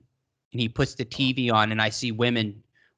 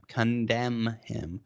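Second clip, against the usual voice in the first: careful self-correction; 2 semitones lower, 5 dB quieter, much slower than usual. Real, not acted. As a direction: CONDEMN drawn out slowly, syllable by syllable. Stumbles, then repeats it carefully.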